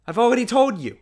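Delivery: high, loud exasperated cry; scolding shout. A narrator's reading. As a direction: snap at the child. Governess scolding the boy.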